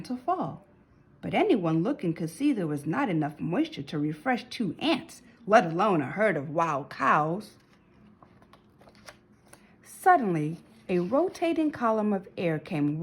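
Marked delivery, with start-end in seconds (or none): none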